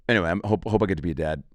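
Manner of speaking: normal talking voice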